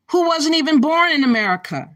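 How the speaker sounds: usual speaking voice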